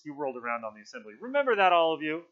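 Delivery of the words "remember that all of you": high, loud warning to all. A narrator's reading. Piggy rounds on the assembly, loud and insistent. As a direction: throw it out to the whole group.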